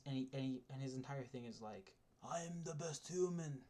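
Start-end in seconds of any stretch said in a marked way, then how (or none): 2.2-3.6 a clumsy, higher put-on foreign accent on "I am the best human"; mock a muscleman character in a badly faked accent; first attempt at a bad villain accent. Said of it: "That's, that's a bad accent".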